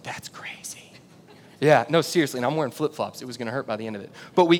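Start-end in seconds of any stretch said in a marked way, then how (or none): 0.0-0.9 hushed whisper, barely there; whispered aside. Retold or imitated. Women in the stands whispering their amazement. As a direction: breathe it as a secret remark. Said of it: "a bunch of females are like".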